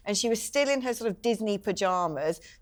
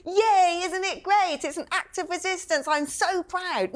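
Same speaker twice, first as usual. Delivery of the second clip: 1 mocking the student; 9 semitones higher, slower than usual. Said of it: "there she is going".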